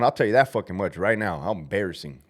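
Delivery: ordinary delivery, nothing marked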